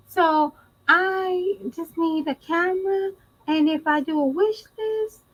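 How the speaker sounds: mocking Jazz. Mocking a streamer asking fans for gifts, high put-on voice. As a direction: pitch it way up to imitate her. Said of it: "It, it sounds like this".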